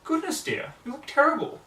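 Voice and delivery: high, loud dismayed Rarity voice; concerned Rarity. Acted.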